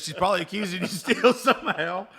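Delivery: laughing. Real, not acted. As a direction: laugh out the joke. Joking, laughing at the story.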